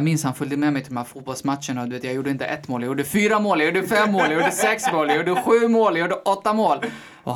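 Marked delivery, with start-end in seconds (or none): 2.8-6.8 louder, higher with each goal count; a boy's goal tally climbing to a proud peak; excited bragging. Retold or imitated. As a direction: pile the goals on, getting more thrilled.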